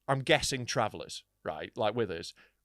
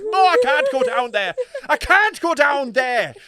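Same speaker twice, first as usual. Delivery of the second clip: high, loud Irish wail of refusal; hammed-up scared traveller; mock terrified; 9 semitones higher, 10 dB louder than usual. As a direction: wail it, dragging out the end. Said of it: "they're shit scared".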